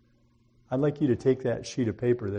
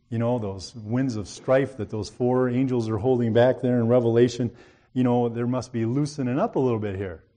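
louder preaching build about end times; 6 dB louder than usual. Acted. Preacher rousing the congregation, louder and urgent.

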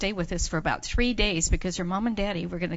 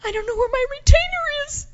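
put-on high, fast, loud child's wail; mock tearful; 7 dB louder, faster than usual. Girl telling Missus Nelson of her loss, high mock-tearful panic. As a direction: play a distressed girl, high and hurried. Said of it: "she started crying".

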